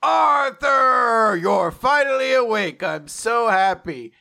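high, loud, drawn-out cry of delight; joyful mock scream. Someone greets newly awakened Arthur, joyful mock scream. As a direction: shriek the name, then ease down happily. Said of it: "someone screams".

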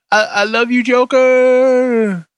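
high, gushing I love you; mock love declaration. Host gushing to the Joker, high and swooning.

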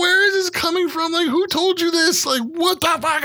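high-pitched, flustered questioning; hammed-up alarm; frantic disbelief. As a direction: squeak the questions like a panicked dad. Dad frantically questions the source.

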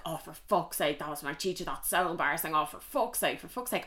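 muttered swearing. Retold or imitated. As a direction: grumble it under your breath like an embarrassed teen. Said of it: "he was like".

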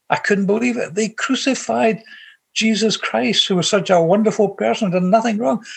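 choked up over the crucifixion; emotional, near tears. Real, not acted. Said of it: "Sorry for being so emotional".

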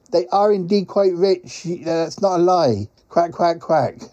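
lightly voiced, quack-punctuated character; rueful quacking creature. Quacking visitor blames the rich pies, lightly rueful creature voice. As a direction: play a mildly complaining quacking creature.

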